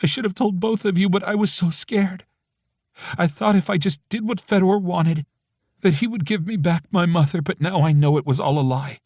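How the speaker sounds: through tears. A narrator's reading. Friend admitting her failure, through tears. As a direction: push the words out through tears. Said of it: "she says through tears".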